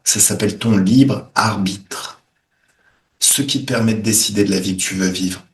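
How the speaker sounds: soothing healer voice; guided meditation. Acted. Guides a healing session.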